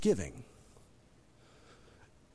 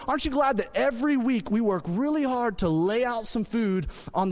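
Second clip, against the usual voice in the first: higher, louder, driving sermon voice; full-force preaching; 7 semitones higher, 3 dB louder than usual. Acted. Pastor rallying the congregation, full-force preaching. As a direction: preach it out with rising fire.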